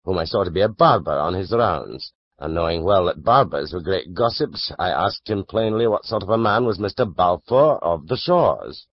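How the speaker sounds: ordinary delivery, nothing marked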